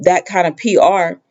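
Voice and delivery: ordinary delivery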